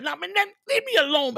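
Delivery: high-pitched mock protest; pestering crackhead. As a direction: imitate an addict brushing off a lecture. Acting out the crack addict's replies.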